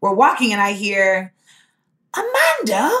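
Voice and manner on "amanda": a high call of her name, then a plain repeat. Retold or imitated. Retelling a stranger calling her name from behind.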